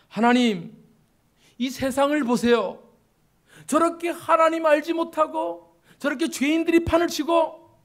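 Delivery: loud, high-pitched grievance poured out to God; resentful complaint. Acted. Prophet pouring out resentment to God over sinners thriving, resentful complaint. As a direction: raise your voice in indignant reproach. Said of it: "라고 하는 원망을 하나님 앞에 쏟아내고".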